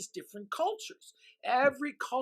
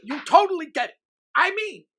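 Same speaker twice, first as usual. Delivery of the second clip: enthusiastic; 6 semitones higher, 11 dB louder than usual. Acted. Host enthusing loudly.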